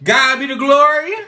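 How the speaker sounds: high, loud joyful praise; rejoicing out loud, a little churchy. Real, not acted. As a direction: lift it up like a church shout. Celebrating two years home.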